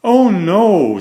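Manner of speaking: a sudden loud, high "Oh no"; alarmed cry. Acted. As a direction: blurt it out in alarm. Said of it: "said Bear".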